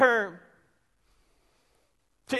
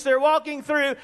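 usual speaking voice

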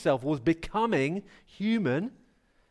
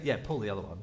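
sceptical; 10 semitones lower, 7 dB quieter, much faster than usual. Retold or imitated. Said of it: "She's not saying".

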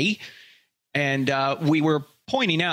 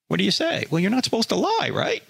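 normal talking voice

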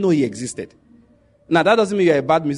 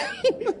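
high laugh; 4 dB quieter than usual. Real, not acted. Laughing after the punchline.

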